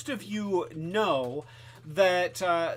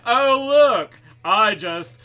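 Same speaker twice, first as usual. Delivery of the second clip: louder, higher, slowed mock gloating; mock villain gloat; 4 semitones higher, 8 dB louder, slower than usual. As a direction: gloat over the lost face, hammed-up. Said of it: "It's like".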